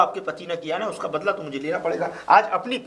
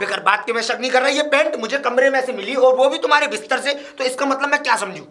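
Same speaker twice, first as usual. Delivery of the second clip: higher, heated demand for an explanation; angry accusation; 5 semitones higher, 4 dB louder than usual. Acted. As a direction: argue back at your wife, pitch raised. Acted quarrel, suspicious husband.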